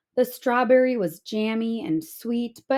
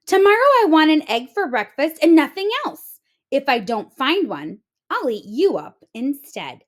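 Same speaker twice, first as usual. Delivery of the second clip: loud, higher, fast threat; angry threat; 5 semitones higher, 6 dB louder than usual. A narrator's reading. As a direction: bark the threat at the hen. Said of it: "he shouted".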